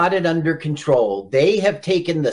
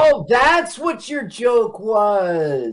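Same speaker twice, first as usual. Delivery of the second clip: mock realisation; 5 semitones higher, slower than usual. Mock realisation of co-host's joke.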